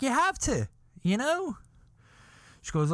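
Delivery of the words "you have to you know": high pleading insistence. Retold or imitated. Retold argument with girlfriend.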